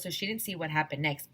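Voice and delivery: ordinary delivery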